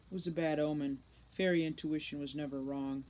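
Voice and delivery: plain reading voice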